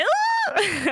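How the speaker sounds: laughing ew. Real, not acted. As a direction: squeal it through a laugh. Laughing disgusted shout.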